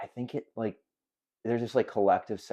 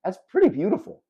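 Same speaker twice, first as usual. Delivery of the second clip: gushing; 10 semitones higher, 6 dB louder than usual. Real, not acted. Gushing about team together.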